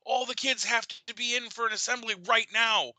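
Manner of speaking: high frantic announcement; urgent alarm. Retold or imitated. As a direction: sound urgently worried. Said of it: "I'm like".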